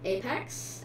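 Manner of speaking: normal talking voice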